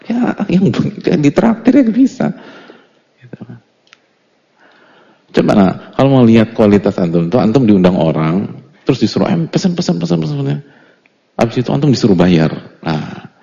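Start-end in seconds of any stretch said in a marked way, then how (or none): 0.1-2.3 high, slow teasing sing-song; mocking high voice. 9.3-10.6 high, hurried urging to order; a host pushing guests to order more; eager host. Retold or imitated. Voicing generous host, high.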